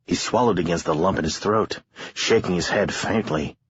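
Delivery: plain reading voice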